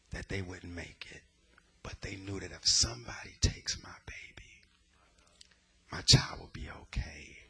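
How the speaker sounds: whispered story. Retold or imitated. Therapist telling of mothers lifting babies, whispered. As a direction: hush it like a sacred secret.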